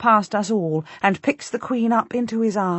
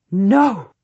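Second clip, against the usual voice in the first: loud horrified no; horrified cry; 6 dB louder than usual. Acted. Duchess crying out at the embracing lovers, horrified loud no. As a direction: cry out in horror. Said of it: "I cry again, utterly horrified".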